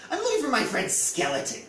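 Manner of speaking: high bright goblin calling out; eager goblin. A narrator's reading. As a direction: chirp it up eager and hopeful. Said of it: "said Goblin".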